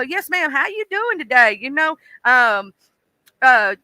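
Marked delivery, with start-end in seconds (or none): 0.0-1.6 higher, sweet-mannered hello; Southern politeness to a stranger at the gas station; polite Southern greeting. Retold or imitated. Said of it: "I just go".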